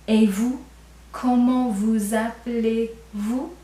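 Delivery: "Et vous? Comment vous appelez-vous?" said slowly, leaning on each vous; slow, careful demo stressing vous; formal vous stressed. Shown as a demonstration. Teacher to class, stressing the formal vous slowly. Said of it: "Did you hear it?".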